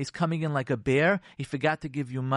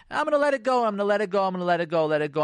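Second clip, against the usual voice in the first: high, loud, insistent chant sliding down; passive aggressive; 5 semitones higher, 5 dB louder, faster than usual. Acted. Spouse holding it in before the fight, passive-aggressive. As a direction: repeat it like pressure building under a lid. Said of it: "And then become passive aggressive".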